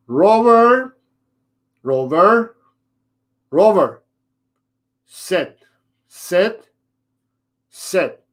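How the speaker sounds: high name calls, then a string of nagging sits; mocking the owner. Trainer imitating the owner's repeated calls, nagging. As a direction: play an owner who keeps repeating commands. Owner gets it wrong again.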